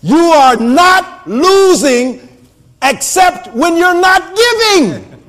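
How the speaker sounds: slow, deliberate pulpit delivery; emphatic preaching. Real, not acted. Preacher making listeners understand giving, emphatic preaching. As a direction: stretch each word out, rising in pitch.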